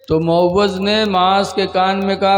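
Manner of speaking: ordinary talk, nothing marked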